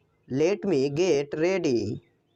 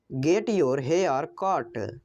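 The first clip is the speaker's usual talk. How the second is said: slow, lower "Get your hair cut"; slow repeat; 3 semitones lower, slower than usual. Shown as a demonstration. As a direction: repeat it slowly so learners can follow. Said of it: "English sentence said twice". (धीरे और साफ़ दोहराना)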